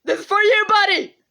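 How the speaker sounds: ordinary delivery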